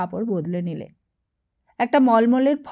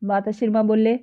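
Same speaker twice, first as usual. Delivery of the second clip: plain reading voice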